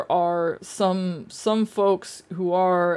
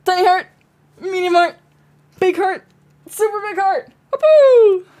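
higher and louder with each heart; building excitement; 10 semitones higher, 6 dB louder than usual. Pretend. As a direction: build to a squeal. Little celebration, building.

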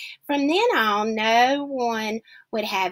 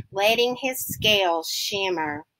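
plain reading voice

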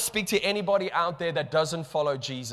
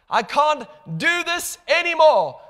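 much higher and louder on anymore; rising desperate cry; 10 semitones higher, 7 dB louder than usual. Acted. Believer surrenders to God, higher louder desperate cry. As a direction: let it break out desperately. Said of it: "we say".

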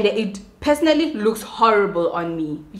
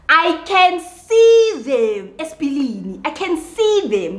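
high, slow, drawn-out insistence; exasperated, pitched up and slowed; exasperated emphasis; 6 semitones higher, 4 dB louder, slower than usual. Real, not acted. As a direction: stretch every word, fed up. Ranting about her eyebrows.

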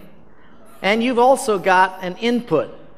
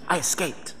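hushed confession; 9 dB quieter than usual. Retold or imitated. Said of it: "Why you're whispering?".

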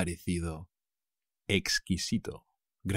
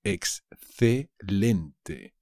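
Excelente drawn out very slowly; slow model word; much slower than usual. Shown as a demonstration. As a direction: stretch Excelente syllable by syllable. (Excelente muy despacio)

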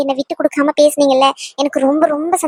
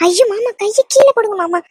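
distressed pleading; 6 semitones higher than usual. Acted. Pleading with elder to lower hands.

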